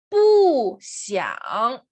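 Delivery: Bu falling hard, xiang dipping; fourth then third tone. Shown as a demonstration. Teacher keeping fourth tone, Bu falls, xiang dips. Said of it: "Mantenemos el cuarto tono".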